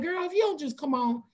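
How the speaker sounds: high-pitched mock nagging of a friend; playful banter. Urging a friend across the street, playful banter. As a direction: tease her into hurrying up, pitched high. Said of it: "like, playful banter".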